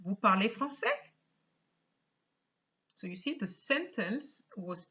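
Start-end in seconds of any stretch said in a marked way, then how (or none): 0.0-1.0 rising question tune on français; question rise. Shown as a demonstration. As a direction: lift the voice on français at the end. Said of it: "this is what you get".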